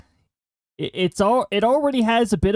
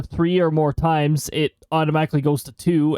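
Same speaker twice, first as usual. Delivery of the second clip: ordinary talk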